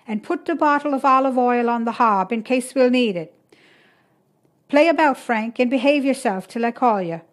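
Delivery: busy midwife voice; brisk orders. A narrator's reading. Maura giving orders to son.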